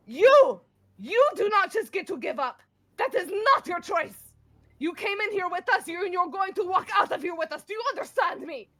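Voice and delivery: high, loud commanding plea; fierce demand. Acted. Grendel demanding Emrys walk out alive, high fierce shouting. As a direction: order him to live. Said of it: "be like".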